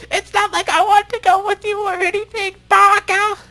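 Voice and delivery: put-on very high squeaky anime girl; shrill falsetto tsundere huff. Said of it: "That, that was a creepy voice".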